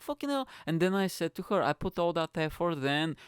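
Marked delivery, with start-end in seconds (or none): 0.0-0.5 high-pitched mock disbelief; exasperated. Unrewarded man after ordering, high mock exasperation. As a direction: complain in a squeaky, put-out voice. Acting his exasperation at no praise.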